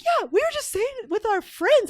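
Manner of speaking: put-on high teen voice, a touch defensive; kid's voice. Teens telling mom what they said, high and defensive. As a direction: play the kids explaining themselves.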